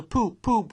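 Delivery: high, loud blaring horn imitation; loud, high horn blare; brazen horn shout. A narrator's reading. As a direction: blast it like a motor horn. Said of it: "rang with a brazen shout".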